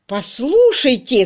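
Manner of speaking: loud slow angry shout. A narrator's reading. Crutchley shouting at the clerk, losing his temper. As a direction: bark it to stop them talking. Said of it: "закричал Крачли".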